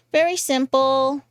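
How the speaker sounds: normal talking voice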